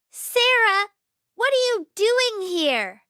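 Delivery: high, loud angry yell. A narrator's reading. Manager catching the maid in the lobby, angry yell. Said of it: "Missus Karen yells".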